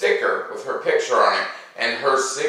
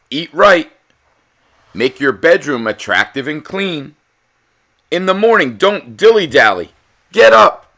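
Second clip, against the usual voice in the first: loud, higher drill orders building to a shout; commanding coach; 6 semitones higher, 9 dB louder than usual. Acted. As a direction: bark it like a fitness coach rousing pupils. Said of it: "he told his pupils".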